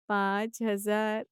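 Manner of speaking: पाँच हजार drawn out slowly and levelly; slow shocked repetition; stunned echo. Acted. Mother stunned by the amount.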